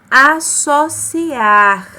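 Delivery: associar drawn out slowly with a clear hissing ss; slow demo word. Shown as a demonstration. Teacher modelling the word for pupils, very slow. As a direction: stretch each syllable of associar, sharp s. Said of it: "Ele aparece com som de S".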